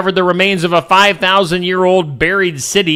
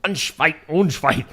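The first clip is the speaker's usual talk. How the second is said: mock German accent; 4 dB quieter than usual. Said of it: "German mode".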